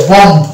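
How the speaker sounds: বম্ব with the final b sounded; spelled-out pronunciation. Shown as a demonstration. Teacher showing the letter-by-letter বম্ব. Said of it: "actual উচ্চারণ হওয়ার কথা ছিল".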